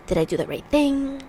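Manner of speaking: whispered self-doubt; anxious whisper. Retold or imitated. Lying awake doubting herself, a worried whisper. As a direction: hush it like a secret worry. Inner worrying voice.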